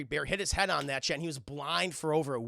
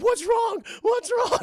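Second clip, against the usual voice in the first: high, loud panicked squeal; Daniel Stern, scared; 9 dB louder, slower than usual. Retold or imitated. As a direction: ask in hammed-up panic. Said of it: "He's just so scared".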